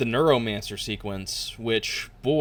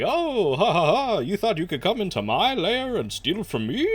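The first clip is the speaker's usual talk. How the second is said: high, cackling villainess; mustache twirling villain; 9 semitones higher than usual. Pretend. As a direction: gloat like a hammy cartoon villainess. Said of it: "mustache-twirling villainess".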